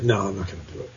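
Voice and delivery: hushed, talking himself out of it; a low murmur to himself; quiet inner voice. Retold or imitated. Retelling his own hesitation.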